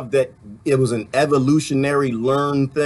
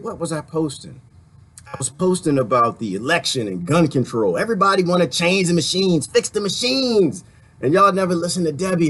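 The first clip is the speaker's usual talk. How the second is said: normal talking voice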